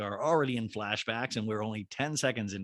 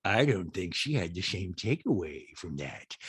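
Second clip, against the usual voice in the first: low put-on celebrity voice; low impersonation of a doubting friend; Rinna; 6 semitones lower, 3 dB quieter than usual. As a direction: drop the pitch for a mock objection. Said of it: "And Rena's like".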